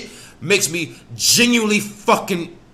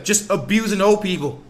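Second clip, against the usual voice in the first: usual speaking voice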